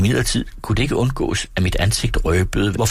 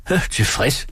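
merrily; 6 semitones higher than usual. Acted. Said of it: "svarede han lystigt".

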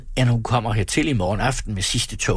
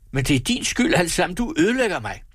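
high-pitched raging blame; furious accusation; 6 semitones higher than usual. A narrator's reading. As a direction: accuse her, pitch climbing. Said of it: "trippede rasende om".

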